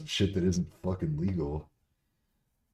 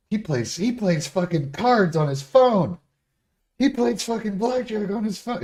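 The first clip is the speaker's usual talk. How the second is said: loud high incredulous rant; loud high exasperated rant; 9 dB louder than usual. Real, not acted. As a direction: rail at the hypocrisy. Ranting about the partner.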